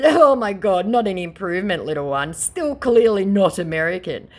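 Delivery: mocking retort. Mocking the scammer aloud.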